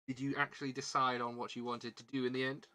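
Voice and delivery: ordinary talk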